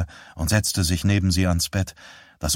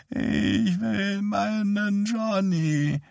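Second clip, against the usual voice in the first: put-on high, slow, softer whine of a sick old woman; whiny old mother; 13 semitones higher, 4 dB quieter, much slower than usual. Dying mother whines that she wants her Johnny. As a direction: whimper it like a fretful child. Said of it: "sagte sie quengelnd".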